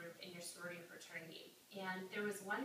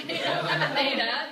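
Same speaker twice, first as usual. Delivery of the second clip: normal talking voice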